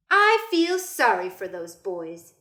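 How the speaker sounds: slow, knowing grandmother; lightly voiced grandmother; Nana. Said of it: "she told him".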